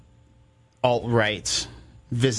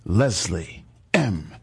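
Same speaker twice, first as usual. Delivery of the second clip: slow smooth intro voice; slower than usual. Acted. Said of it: "with the slowness and the voice".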